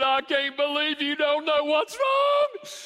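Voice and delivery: high, loud, slow wounded wife voice; hammed-up tearful wife; mock hurt wife. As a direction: play the upset wife crying out. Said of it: "she's crying".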